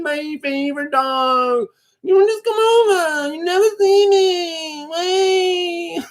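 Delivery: high, slow nagging lonely woman; mock needy relative, guilt-tripping; naggy voice. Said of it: "sound like that one up with a really naggy voice".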